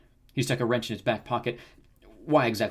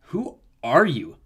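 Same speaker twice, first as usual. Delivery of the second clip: slow, louder, mildly haughty question; scowling challenge; 4 semitones higher, 7 dB louder, much slower than usual. A narrator's reading. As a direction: challenge the stranger, drawing it out. Narcissus scowls at Leo.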